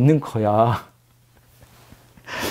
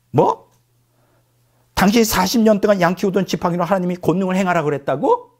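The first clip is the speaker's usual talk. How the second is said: wife yelling; 7 semitones higher than usual. Retold or imitated. Wife scolding Moses over his mission, high indignant rant. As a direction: rail at a husband you think has gone mad, pitched high. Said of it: "남편 모세에게 소리 지르기 시작했다".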